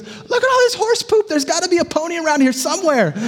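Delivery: very high, fast, shouting with glee; thrilled son. Acted. Optimist son gleefully telling dad a pony must be near, high shout. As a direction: burst out in giddy delight.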